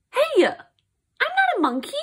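very high, offended squeal; loud, high mock indignation; indignant protest. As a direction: object in high-pitched outrage. Reader protests the book's words.

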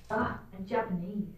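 ordinary talk, nothing marked

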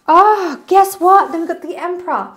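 high and loud, bursting with news; excited reveal. Real, not acted. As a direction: announce it like a thrilling surprise. Excited reveal of the next card.

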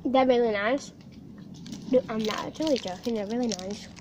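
chewing while talking; sticky candy in mouth; mouth full of taffy. Real, not acted. Seen 0: Speaker puts wrapped candy in mouth earlier, jaw working while talking.

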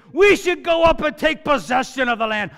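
loud, high, bold rallying call; rallying the fearful people, loud and bold; Caleb's bold call. Retold or imitated. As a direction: shout it like a brave leader. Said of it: "Caleb pipes up".

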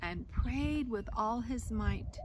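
lively storybook reading voice; reading to children. A narrator's reading. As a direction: read the tale aloud to a class. Reads picture book aloud.

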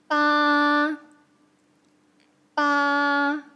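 八 in a level first tone; drawn-out 八 with no rise or fall; tone demonstration. Shown as a demonstration. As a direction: hold 八 long on one steady pitch. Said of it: "Tell us the tone of each".

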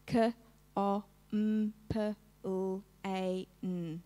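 "K, O, M, P, L, A, N" as separate phonemes, kuh, o, mmm, puh, lll, ay, nnn; sounded out. Shown as a demonstration. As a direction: segment "K, O, M, P, L, A, N" sound by sound, not letter names. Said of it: "Show me the sounds".

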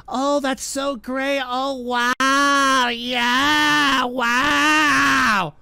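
drawn-out wail of fake delight; mock gushing. Host mimics weebs in the chat, mock gushing.

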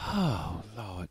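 usual speaking voice

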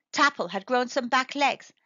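plain reading voice

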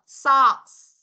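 loud, emphatic "Socks" with hissed S; final S let out. Shown as a demonstration. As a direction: hiss the end of "Socks". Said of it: "sờ ra cho cô nào một lần nữa".

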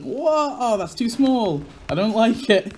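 loud, high dismay at the size; shocked it's so tiny; surprised whoa. Real, not acted. Player shocked by the tiny cafe, loud dismay.

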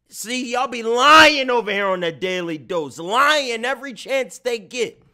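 high, loud calling-out; loud accusation. Real, not acted. Streamer calling out Daily Dose for lying, loud accusation. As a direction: raise your voice and expose the lie.

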